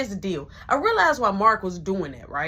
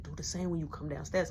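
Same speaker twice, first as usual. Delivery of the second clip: breathy hushing whisper so mama stays asleep; urgent whisper; 5 semitones lower, 11 dB quieter than usual. Retold or imitated. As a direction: shush him in a tight whisper. Sneaking at night.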